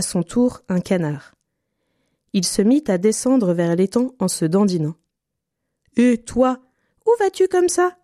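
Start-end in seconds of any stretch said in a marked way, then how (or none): 6.0-8.0 high piping bird call. A narrator's reading. Little bird calling to the duck, high chirpy call. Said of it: "lui cria le petit oiseau".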